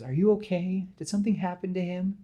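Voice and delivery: high, concerned acted questions; worried questioning. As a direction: ask anxiously, pitched well up. Aunt May worries about Peter.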